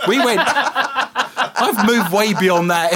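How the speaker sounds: slow, laughing through the words. Real, not acted. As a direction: laugh it off as you speak. Laughing while taking over.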